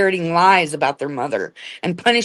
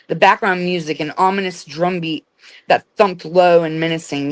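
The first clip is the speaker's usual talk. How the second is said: ordinary delivery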